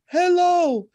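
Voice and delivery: sudden high squeal of hello; high, loud mock surprise; surprised hello. Mock exclaiming at herself.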